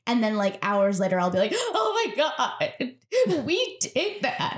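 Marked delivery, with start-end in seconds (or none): 1.5-4.6 high, drawn-out tearful wail; mock upset. Host acting out delayed panic hours afterwards, mock upset. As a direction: play up a delayed meltdown, voice way up. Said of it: "Like, all upset".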